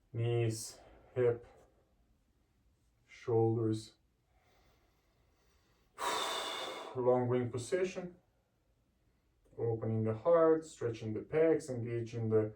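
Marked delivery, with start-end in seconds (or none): 6.0-6.9 soft sigh. Real, not acted. Exhale during stretch.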